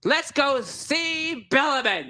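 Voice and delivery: slow, high, pompous nobleman; grandly introducing himself, a pompous gnome lord. Acted. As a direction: greet them like a self-important lord. GM voices Lord Wheaterton.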